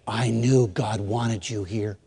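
soft, low and slow; hushed, solemn. Retold or imitated. Leader across the table to student, hushed and solemn. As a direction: say it slowly, softly, with quiet weight. Mentor's solemn words retold.

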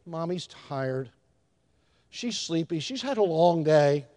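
soft, low, gentle musing; mock-sweet hushed sympathy; considerate toddler. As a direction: play an impossibly understanding infant, quietly. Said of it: "an infant or a little toddler sit down and say".

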